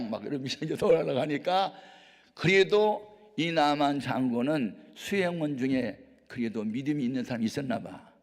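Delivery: ordinary talk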